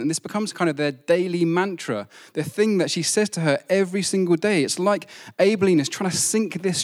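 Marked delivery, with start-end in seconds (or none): none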